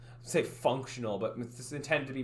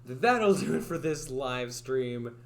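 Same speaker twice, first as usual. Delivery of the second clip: 1 stream outro; 5 dB louder, slower than usual. Shown as a demonstration. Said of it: "I'll try that again".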